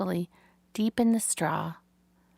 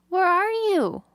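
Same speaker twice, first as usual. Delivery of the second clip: high, louder worried little pig voice; anxious young pig; 12 semitones higher, 5 dB louder, slower than usual. A narrator's reading. Wilbur calls into the dark for Charlotte, worried and high. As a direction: call out into the dark like a lost piglet.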